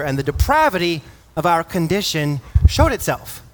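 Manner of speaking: rising, emphatic preaching voice. Acted. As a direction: drive home the point with full force.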